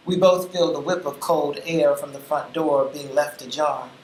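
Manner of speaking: plain reading voice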